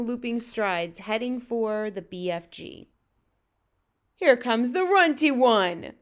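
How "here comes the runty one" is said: slow, loud, higher giant's taunt; booming. A narrator's reading. Huge giant announcing the runty one's arrival, booming. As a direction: boom it out like a bullying giant. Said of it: "boomed the flesh lump eater".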